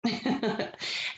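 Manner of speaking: soft, breath-only giggle; brief chuckle. Real, not acted. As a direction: give a short, light laugh. Amused by the page.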